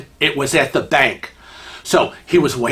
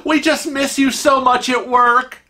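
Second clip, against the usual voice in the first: high, effusive fondness; gushing lady; 8 semitones higher, slower than usual. Acted. Lady mistakes Kevin for coworker Jerry, gushing. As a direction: gush at a long-missed coworker, pitched high. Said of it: "she's like".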